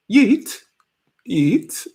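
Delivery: trying an English accent. Shown as a demonstration. Testing how yeet sounds, in a playful English accent. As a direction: try the word in a put-on English accent. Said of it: "How would you say yeet in, like, a English accent?".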